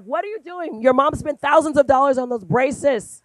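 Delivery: loud, high playful scold; loud mock scolding. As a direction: tease her loudly for hiding her smile. Teasing niece about hiding smile.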